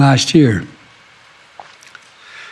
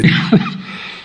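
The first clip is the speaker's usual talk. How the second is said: coughing. Real, not acted. Speaker coughing mid-speech.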